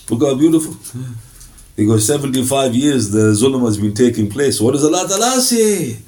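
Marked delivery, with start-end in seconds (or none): none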